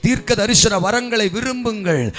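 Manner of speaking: preacher urging the crowd, each line rising; fervent preaching. Acted. Pastor exhorting the congregation to desire gifts, fervent loud chant.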